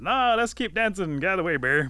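loud, high, pushy refusal; bossy outburst. Acted. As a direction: shove them aside with your voice. Disguised Rabbit brushing Bear off.

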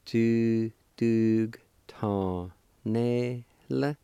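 Tu dug ta ne la with each syllable drawn out and separated; teacher modelling Tu dug ta ne la for beginners; slow, syllable by syllable. Shown as a demonstration. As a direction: pace out Tu dug ta ne la very slowly. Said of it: "pronounce the G sound with the D U like this".

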